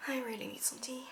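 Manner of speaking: a soft, strained, breathy aside about needing tea; tired whisper. Real, not acted. Vlogger with a sore throat whispers to camera. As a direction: voice giving out, whispers that she needs tea. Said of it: "my throat is not doing so sweet".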